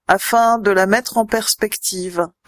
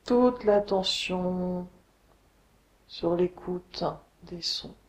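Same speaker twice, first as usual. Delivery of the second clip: guided meditation; 3 semitones lower, 5 dB quieter than usual. Acted. Yoga teacher guiding listeners lying on the floor, meditation voice. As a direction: guide them unhurriedly into deep rest, letting phrases settle. Leads a Yoga Nidra relaxation.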